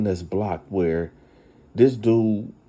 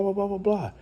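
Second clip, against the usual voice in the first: ordinary talk, nothing marked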